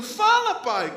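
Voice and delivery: put-on high, loud impatient kid's cry; almost shouting. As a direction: nearly yell it like an excited five-year-old. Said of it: "Quase gritando assim".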